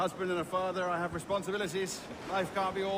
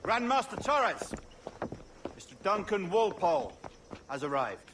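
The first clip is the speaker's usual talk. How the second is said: formal announcement; 3 semitones higher than usual. Acted. Formally announcing guest arrival.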